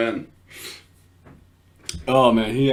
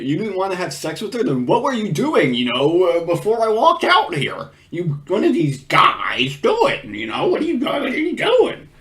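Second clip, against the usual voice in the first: Chris Hansen; 5 semitones higher than usual. Acted. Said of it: "missed opportunity by Chris Hansen to say".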